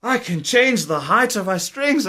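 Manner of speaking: high, excited discovery; light up as it dawns on you; hammed-up amazement at adjustable strings; freaking out. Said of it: "And I freaked out".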